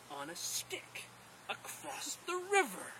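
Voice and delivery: slow, breath-only start rising to high squeak; awed idea. Acted. First man plans riding a stick across, hushed slow amazement. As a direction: draw it out from a whisper into high wonder.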